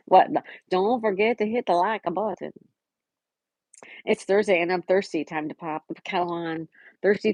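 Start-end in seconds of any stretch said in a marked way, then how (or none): none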